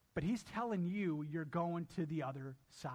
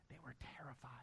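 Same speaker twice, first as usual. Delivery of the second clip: hushed whisper of fear; whispered dread; 3 semitones lower, 16 dB quieter, much faster than usual. A narrator's reading. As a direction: breathe it out in awe. Narrating the disciples' fear.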